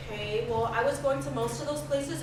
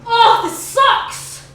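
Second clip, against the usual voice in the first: frustrated shout; 9 semitones higher, 14 dB louder, much slower than usual. Acted. Frustrated outburst at bad news.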